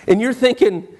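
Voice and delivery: normal talking voice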